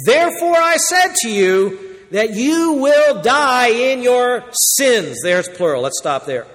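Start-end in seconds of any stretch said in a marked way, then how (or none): 0.1-5.2 slow, high, loud pronouncement of judgment; solemn proclaimed warning. Acted. Preacher voicing Jesus warning proud hearers, slow and loud. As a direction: boom out each phrase slowly like a prophet.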